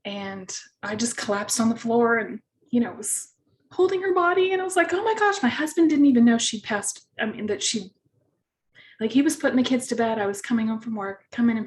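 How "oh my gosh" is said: high shocked gasp of words; a sudden, much higher cry of dismay; retold shock, pitched way up. Retold shock finding dead dog.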